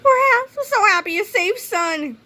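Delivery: high, tearful relief; relieved mother. A narrator's reading. Mother relieved her son is safe, high gushing. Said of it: "cried Missus Needley".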